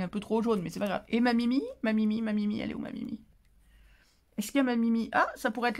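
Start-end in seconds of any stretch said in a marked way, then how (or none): 2.6-3.2 whispered. Real, not acted. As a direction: whisper as if looking for a pet. Searching for her cat's picture.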